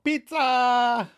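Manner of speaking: high, drawn-out delivery call. Retold or imitated. Caitlin calls out from the hall, calling. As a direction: sing it out down the hall. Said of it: "calls out from the hall".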